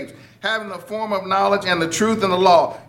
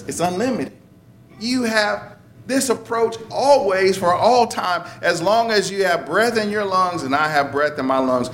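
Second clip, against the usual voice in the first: ordinary delivery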